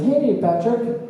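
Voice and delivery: high, cheery boss voice; upbeat mock boss praise; boss praising. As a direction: pat him on the back with your voice. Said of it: "pats you on the back and says".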